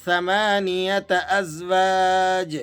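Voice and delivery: ثمانیت ازواج recited slowly; slow recitation. Shown as a demonstration. Teacher reciting the verse opening slowly. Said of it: "اس آیت مبارکہ کے شروع کا حصہ".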